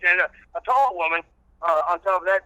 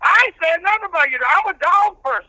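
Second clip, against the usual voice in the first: country preacher character; 9 semitones higher than usual. Acted. Old reverend preaching patience to the radio host, in full preacher voice. Reverend rants about dog toys.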